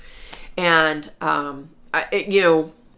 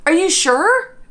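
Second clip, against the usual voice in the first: husband's disbelieving question, pitched much higher; mock his stunned doubt, higher; husband asking in disbelief, pitched higher; imitating husband; 10 semitones higher, 4 dB louder than usual. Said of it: "He goes".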